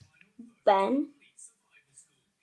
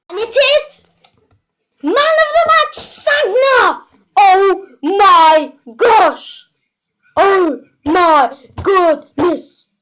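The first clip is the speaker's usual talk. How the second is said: much higher and louder, screaming with joy; ecstatic shout; 7 semitones higher, 8 dB louder than usual. Real, not acted. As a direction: explode with delight at the reveal. Big reveal of star card.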